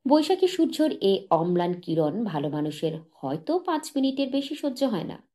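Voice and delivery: ordinary delivery, nothing marked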